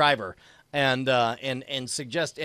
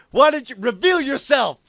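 louder, higher hammed-up challenge; mock demand; 7 semitones higher, 6 dB louder than usual. Player challenging the helmeted stranger, mock demanding. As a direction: demand it like a showy action hero.

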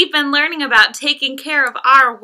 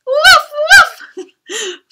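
high, louder woof breaking into a laugh; laughing puppy bark; 13 semitones higher, 5 dB louder than usual. Acted. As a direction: bark through giggles like a wet puppy. Puppy shakes water, laughing.

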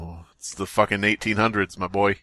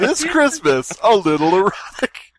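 movie trailer voice; 6 semitones higher, slower than usual. Pretend. Pitching a joke movie to friends, mock trailer hype. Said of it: "Epstein Productions presents".

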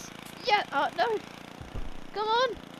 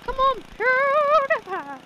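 hyped cheer; 12 semitones higher, 9 dB louder, slower than usual. Acted.

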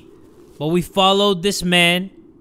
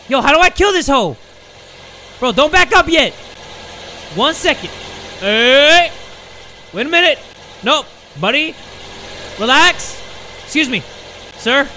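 high, loud exclamations; urgent shouting; 5 semitones higher, 7 dB louder than usual. Real, not acted. Streamer yells at departing game driver, urgent shouts. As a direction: shout to stop him, bewildered. Calls after man driving away in game.

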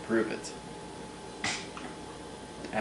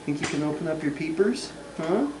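much higher coaxing coo; 9 semitones higher than usual. Real, not acted. Dad coaxes baby to open eyes.

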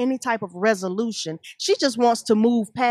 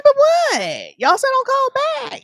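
much higher, louder, playful friend voice; hammed-up girlfriend faking innocence; mock friend; 4 dB louder than usual. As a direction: squeal it up high like a girlfriend. Voicing friend in a playful skit.

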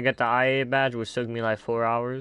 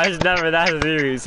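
normal talking voice

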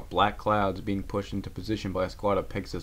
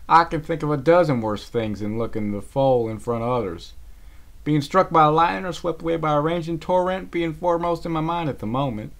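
higher, louder exasperated retort; irritated; 7 semitones higher, 6 dB louder than usual. Acted. Applejack retorts to Rarity, irritated. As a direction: roll your eyes as you say it. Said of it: "rolled her eyes in irritation".

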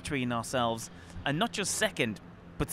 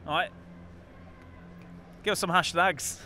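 playful mock Yorkshire, half-hearted; Yorkshire accent; 4 semitones higher than usual. Radio host to his co-host, asking for hashtags in a light mock Yorkshire accent. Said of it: "I've done a Yorkshire accent".

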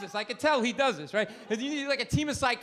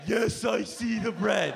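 slow annoyed reply; slower than usual. Retold or imitated. Husband answers slow, annoyed.

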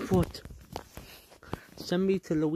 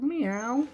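high meow; 9 semitones higher, 4 dB louder than usual. Acted. Cat meowing.

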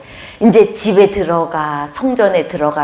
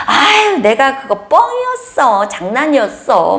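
high, teasing take-back; mock breezy kidding; playful brush-off; 7 semitones higher than usual. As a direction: laugh it off like a joke. Voicing herself joking it off.

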